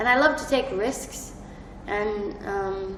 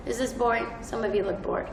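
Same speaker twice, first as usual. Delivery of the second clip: ordinary delivery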